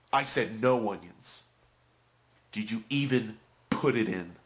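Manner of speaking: loud, slow, indignant complaint; mock outraged customer, hitting each word; angry customer. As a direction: imitate a furious customer chewing out the clerk.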